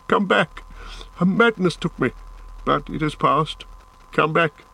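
high-pitched remorseful plea, gradually subsiding; pleading call. A narrator's reading. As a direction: beg him to return, pitch easing down. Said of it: "he called".

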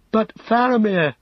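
high, urgent protest; Pippin's cry. A narrator's reading. Pippin protests to Gandalf for Faramir's sake, crying out. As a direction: cry out in high-pitched alarm. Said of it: "cried Pippin".

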